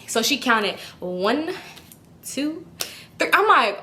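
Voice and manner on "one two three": drawn-out count with long gaps; slow counting. Retold or imitated. Teller counting out cash while customer waits, slow counting. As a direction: count at an agonizingly slow pace, imitating the teller.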